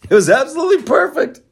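high, fast, giddy mock praise; gleeful delight. Real, not acted. Host gleefully mocking Biden's gaffe. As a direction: squeal it with delight.

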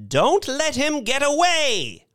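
high-pitched alarmed call; hysterical cry. A narrator's reading. Crowd stopping Mister Thurkell escaping, hysterical cry. Panicked crowd calling after the swindler.

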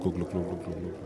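normal talking voice

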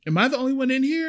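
high, fast, surprised little voice; surprised old lady. Retold or imitated. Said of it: "She's like".

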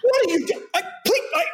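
very high, stammering imitation; flustered. Co-star meeting his replacement actor in Paris, flustered. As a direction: sputter it out, mock-flustered. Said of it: "like, flustered".

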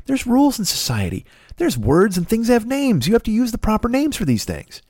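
high-pitched mock scolding; vigilante lecture. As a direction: lecture them like a self-appointed enforcer. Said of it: "Just to go".

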